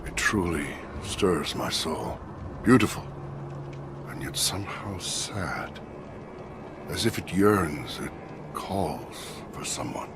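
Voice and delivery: slow, hushed reverie, sinking into a whisper; entranced murmur. Acted. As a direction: breathe it slowly, lost in the music. Entranced alone by distant shamisen music.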